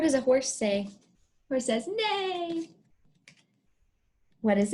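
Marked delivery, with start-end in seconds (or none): 1.9-2.7 mock neigh. Shown as a demonstration. Teacher voicing horse sound.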